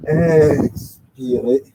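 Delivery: very drawn-out first syllable; slow, sustained exhale cue; breathing cue. Real, not acted. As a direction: lengthen the word to pace a long exhale. Cueing a long exhalation.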